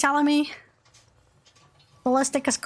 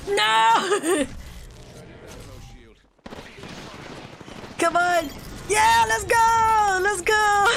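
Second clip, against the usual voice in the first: excited cheering; 6 semitones higher, much slower than usual. Real, not acted. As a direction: cheer the win, voice cracking up. Winning the final fight.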